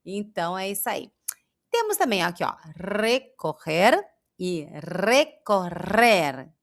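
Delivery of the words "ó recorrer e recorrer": recorrer first with throaty Rs, then with trilled Rs, said slowly; slow minimal pair recorrer; two R sounds. Shown as a demonstration. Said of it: "Vê a diferença".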